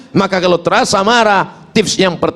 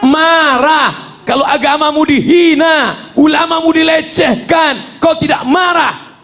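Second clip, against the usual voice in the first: fiery preaching shout; 8 semitones higher than usual. Acted. Preacher to congregation, fiery rising shout. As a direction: rouse the crowd like a firebrand. Preacher rousing anger over insults.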